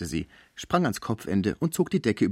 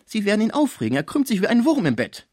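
higher, louder urgent warning; pleading German friend; 12 semitones higher, 5 dB louder, faster than usual. A narrator's reading. Schmucke begging the concierge to stop, high and urgent. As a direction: warn her she'll upset him.

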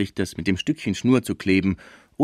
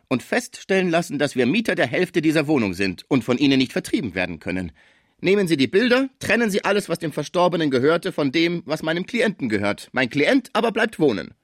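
raised, insistent advocate's voice; streitbarer Villemot; 9 semitones higher than usual. Acted. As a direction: argue firmly for your client, pitched up. (energischer Anwalt, erhobene Stimme)

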